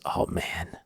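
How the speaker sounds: breathy near-whisper, voice dropped right down; hushed awe. Retold or imitated. Father staring at his son's new truck, whispered awe. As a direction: let it slip out under your breath, impressed.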